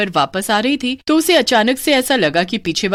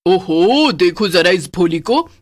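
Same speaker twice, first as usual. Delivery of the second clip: mocking witch; slower than usual.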